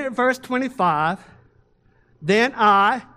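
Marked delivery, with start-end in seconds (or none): 2.2-3.0 "I" hit loud and held for the crowd to answer; a loud cue word "I" for a call and response; stress on I. Shown as a demonstration. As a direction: punch "I" and pause so they can shout God. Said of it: "as soon as I say, "I," I want you to say "God".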